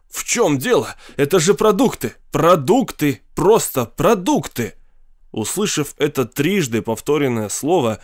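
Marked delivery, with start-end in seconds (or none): 0.1-4.8 high-pitched exasperated outburst; wrathful. A narrator's reading. Professor insisting it is only food, wrathful. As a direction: insist indignantly, hitting each exclamation. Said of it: "гневно проговорил он".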